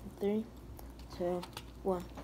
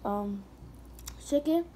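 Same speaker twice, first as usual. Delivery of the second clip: normal talking voice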